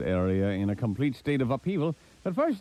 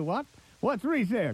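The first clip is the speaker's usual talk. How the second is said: disbelieving question, pitched up; incredulous; 10 semitones higher than usual. Real, not acted. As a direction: ask it like it's absurd. Incredulous at condo resale.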